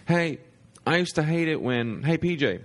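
calling out high and bright from the stage. Retold or imitated. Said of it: "Then he goes".

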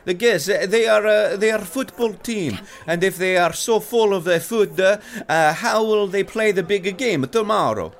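high, loud fretting Italian waiter; Italian accent. Acted. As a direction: worry it out in Italian. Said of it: "both boys speak in thick Italian accents".

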